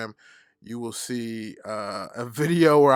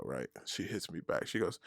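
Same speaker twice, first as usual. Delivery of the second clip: ordinary talk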